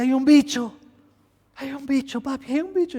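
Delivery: put-on high, tearful child voice; imitating a frightened daughter; scared little girl. (imitando a la hija asustada por un bicho)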